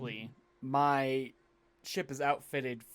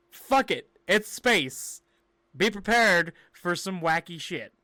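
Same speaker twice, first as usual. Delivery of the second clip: excited; 5 semitones higher, 9 dB louder than usual. Real, not acted. Podcast intro hype.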